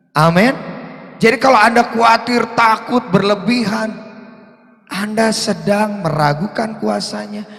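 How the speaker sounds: loud, high, impassioned preaching; loud high sermon build; fiery preaching. Acted. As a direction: preach it full force to the congregation. Preaching at full force.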